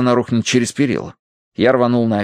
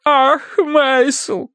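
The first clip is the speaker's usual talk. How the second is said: very high theatrical lament; distraught wife; much slower than usual. A narrator's reading. Wife reproaches her husband, distraught. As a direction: moan the name in distress.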